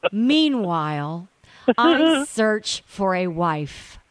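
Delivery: can barely get through the letter for laughing; laughing while reading. Real, not acted. Guest reading an absurd letter to the host, laughing through it.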